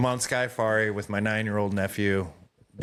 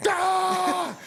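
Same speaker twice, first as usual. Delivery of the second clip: very high mock child's shriek; imitated frightened scream. Four-year-old niece as penguins approach, imitated scream. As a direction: let out a long, high scream. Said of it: "And then she's like".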